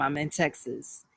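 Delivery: ordinary talk, nothing marked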